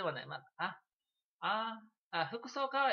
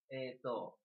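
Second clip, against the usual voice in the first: usual speaking voice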